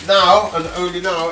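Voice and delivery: slow, loud emphasis on now; emphatic. Real, not acted. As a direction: punch the words only now. Dramatic emphasis on timing.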